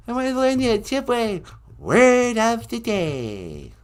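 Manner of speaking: slow, high falsetto impersonation. Acted. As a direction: draw it out like the pop star. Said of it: "It's me, [name]".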